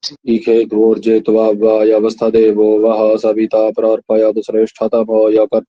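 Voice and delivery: low, heavy voice from the chest; bass-heavy chest recitation; deep chest voice. Shown as a demonstration. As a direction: chant it deep from the chest, like a tiger. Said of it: "एकदम भारी आवाज".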